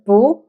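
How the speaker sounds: BU with a rising pitch; second-tone bu. Shown as a demonstration. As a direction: say "bu" rising, as before a fourth tone. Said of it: "followed by another character that carries the fourth".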